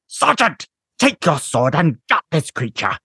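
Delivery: high, barked military order; commanding officer voice. A narrator's reading.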